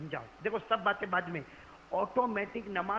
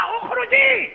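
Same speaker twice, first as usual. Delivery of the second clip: furious, much higher and louder; angry shout; 14 dB louder, much slower than usual. Acted. Abu Huraira drives the woman out, furious shout. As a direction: bark him out of the room. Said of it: "غصے میں کھڑے ہوئے".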